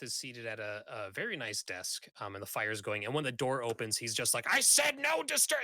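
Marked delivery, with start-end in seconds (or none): 4.5-5.6 high, loud snap at the intruders; annoyed shout. Acted. GM as Kadrith, yelling at the party barging in, loud and high. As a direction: bark it at whoever opened the door.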